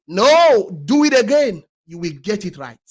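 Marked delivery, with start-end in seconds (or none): 0.1-1.6 firm rebuttal. Real, not acted. Rebutting the quitter.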